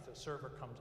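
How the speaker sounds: usual speaking voice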